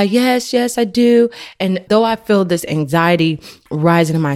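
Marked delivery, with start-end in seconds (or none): 0.0-1.3 higher-pitched mock child reply; kids replying. Kids answering mom's backpack check, higher mimicked reply. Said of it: "And they reply".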